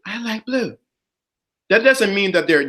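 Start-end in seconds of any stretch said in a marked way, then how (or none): 0.1-0.8 higher, softer repeat; obedient echo. Acted. Woman echoing the opinion given her, softly obedient. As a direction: parrot it back softly in higher pitch.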